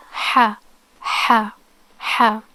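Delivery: "ha" with a breathy constricted H from mid-throat; Arabic ḥa sound. Shown as a demonstration. Teacher demonstrating the Arabic letter to learners, constricted ḥa. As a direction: say each "ha" separately, H squeezed in mid-throat, voiceless. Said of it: "it's pronounced like".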